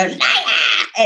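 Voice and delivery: mock jeering wail with a laugh; imitated heckling cry. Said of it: "do that Princess Bride thing".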